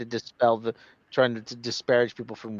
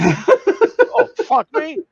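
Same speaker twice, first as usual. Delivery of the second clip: mock outrage; 14 semitones higher, 7 dB louder, much slower than usual. Acting Rodney's outraged reaction.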